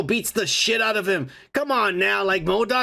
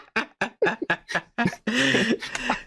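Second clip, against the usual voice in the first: laughing; 3 semitones higher than usual. Real, not acted. Laughter burst.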